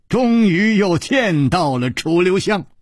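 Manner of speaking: ordinary delivery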